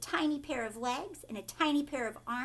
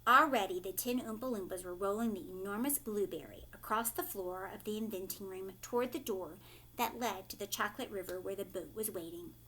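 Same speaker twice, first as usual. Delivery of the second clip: ordinary delivery, nothing marked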